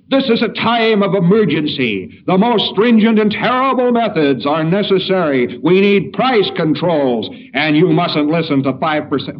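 rally a frightened crowd with doom; alarmist politician. Retold or imitated. Lecturer imitates a fear-mongering politician, loud alarm. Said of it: "the fellow says".

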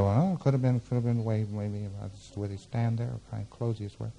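soft, fading thinking aloud; hesitant pondering. Acted. Lecturer acting out a patient weighing possible answers, hesitant and hushed. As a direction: murmur the possibilities, trailing off. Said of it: "he said".

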